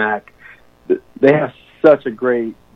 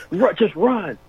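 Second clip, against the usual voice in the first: lightly imitated coach, higher pitch; coach's order; 8 semitones higher, slower than usual. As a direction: retell the coach's command in a raised pitch. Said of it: "Mack tells me to run".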